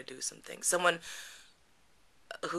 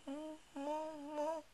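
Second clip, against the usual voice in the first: muffled mouth-shut hums; 13 semitones higher, 9 dB quieter, faster than usual. Retold or imitated. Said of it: "I'm like".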